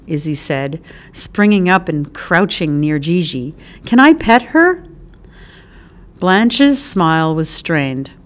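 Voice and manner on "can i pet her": a bright, much higher eager ask; begging like a keen kid. Acted. Eager child asks to pet.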